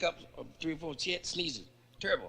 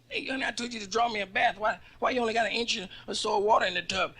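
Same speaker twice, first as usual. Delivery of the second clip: whiny complaint; 7 semitones higher, faster than usual. Acted. Wife complaining about bath.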